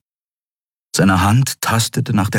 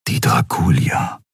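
low, toneless rumble to himself; deep, husky musing; inner thought; 5 semitones lower than usual. A narrator's reading. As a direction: think it aloud in a low murmur. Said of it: "dachte Keru".

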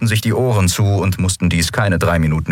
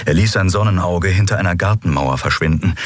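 plain reading voice

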